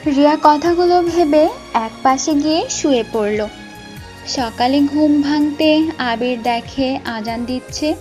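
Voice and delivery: usual speaking voice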